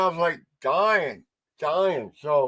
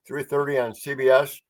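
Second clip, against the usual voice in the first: usual speaking voice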